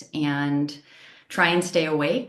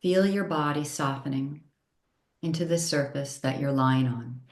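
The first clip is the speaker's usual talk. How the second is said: unhurried yoga nidra guidance, long pauses between phrases; calm, measured meditation voice, each phrase settling downward; guided meditation. Real, not acted.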